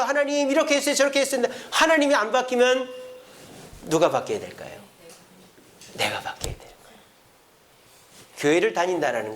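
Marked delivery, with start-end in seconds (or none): 0.0-1.4 fast, high, insistent prayer; a worked-up believer rushing through gripes; rattling off complaints. Pretend. 5.9-7.0 whispered soft conclusion; a quiet whispered confession; hushed whisper. Real, not acted. Preacher's hushed point.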